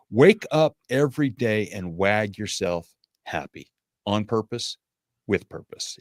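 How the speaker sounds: slow, spaced-out motto delivery; slow, deliberate. Real, not acted. Speaker closing his message to struggling listeners, slow and deliberate. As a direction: drive the takeaway home slowly.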